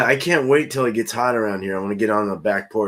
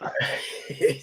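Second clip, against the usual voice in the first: breathless unvoiced wheeze laughing; wheezy laugh; 4 semitones higher, 5 dB quieter than usual. Real, not acted. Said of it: "I'm wheezing over here with my laugh".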